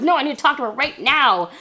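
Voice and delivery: forceful retold urgency; urgent insistence. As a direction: insist it cannot wait.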